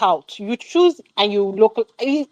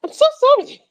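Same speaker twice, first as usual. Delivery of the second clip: much higher, sarcastic sorry; a pitched-up fake apology; mock apology. Real, not acted. Critic apologising to the actress, mock sorry and much higher.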